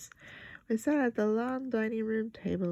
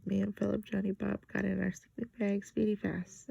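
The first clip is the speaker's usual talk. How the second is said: plain reading voice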